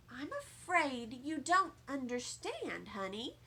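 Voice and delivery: put-on calm a child down; soft, patient mother; gentle mother.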